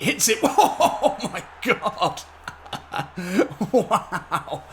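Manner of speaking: high, loud burst of laughing joy; delighted laughter. Real, not acted. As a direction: laugh out loud at a stunning goal. Goal scored, laughing in delight.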